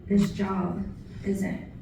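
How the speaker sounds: measured recital, softening on the closing line; poetry reading. Acted. Poet remembering veterans lost at the home, poetry reading. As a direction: recite in paused phrases, letting the ending drop. Continues poem about veterans.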